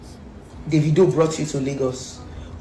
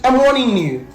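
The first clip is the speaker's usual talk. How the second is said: angry warning; 6 semitones higher than usual. Real, not acted.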